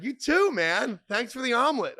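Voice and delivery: overcompensate, caught off guard; awkward loud thanks. Retold or imitated. Awkward reply to the waiter.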